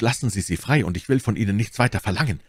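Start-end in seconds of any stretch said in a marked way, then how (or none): none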